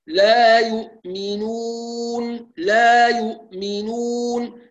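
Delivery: very slow chanted لا يؤمنون; ترتيل بطيء; model recitation. Shown as a demonstration. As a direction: recite لا يؤمنون slowly and evenly. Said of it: "correct model".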